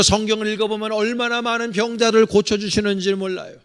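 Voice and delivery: normal talking voice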